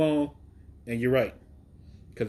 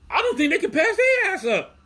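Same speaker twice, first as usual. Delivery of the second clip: laughing excitement; 6 dB louder than usual. Real, not acted. Raving about the new brand.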